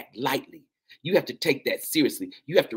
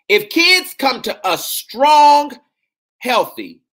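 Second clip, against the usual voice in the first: high, loud and slow, voice soaring; stretched preacher's shout; preaching shout; 12 semitones higher, 12 dB louder, slower than usual. Real, not acted. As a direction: belt it out to the congregation. Preaching the proverb, then repeating it.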